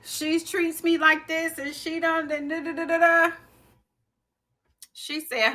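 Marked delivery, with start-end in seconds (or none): 0.0-3.3 higher-pitched complaint, replaying your younger self; mock whining. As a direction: lightly whine it, tailing into da-da-da. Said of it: "Stop whining and crying about it".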